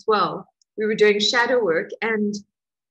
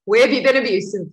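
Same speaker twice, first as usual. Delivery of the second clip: louder, fierce demand to herself; angry self-challenge; 5 dB louder, faster than usual. Retold or imitated. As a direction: steel yourself and demand an answer. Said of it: "I was like".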